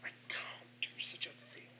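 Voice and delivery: whispered inner thought. Retold or imitated. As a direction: breathe it as a secret thought. Recalling his own hesitant thought.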